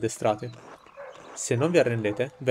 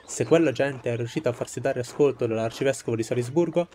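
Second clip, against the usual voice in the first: ordinary talk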